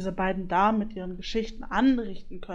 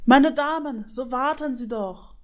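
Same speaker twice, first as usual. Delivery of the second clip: loud, higher, drawn-out plea; calling out; 5 semitones higher, 6 dB louder than usual. A narrator's reading. Griffin calls after the departing goose ladies, calling out. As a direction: call after them to wait, voice raised.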